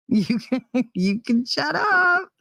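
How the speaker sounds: high, drawn-out playful brush-off; mock retort. Woman playfully telling her friend to shut up, mock retort. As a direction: stretch it out high in mock protest.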